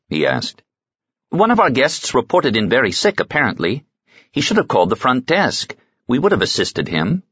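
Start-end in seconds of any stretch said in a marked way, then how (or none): 1.3-7.2 lightly raised voice of a fretting desk clerk; a fussy hotel clerk, a bit higher. Acted. Desk clerk explains a sick guest to Roic, fussy.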